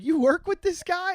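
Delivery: high, incredulous mock accusation; retold indignation. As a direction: imitate a mad friend challenging you. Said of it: "got really mad at me".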